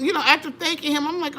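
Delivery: ordinary talk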